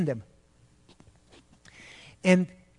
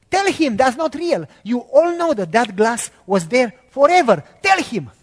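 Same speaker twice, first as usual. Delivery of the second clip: raised, higher voice urging the workers; pleading demand; 4 semitones higher, 6 dB louder than usual. Retold or imitated. To silent coworkers, a raised, pleading demand. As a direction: insist loudly they tell the truth.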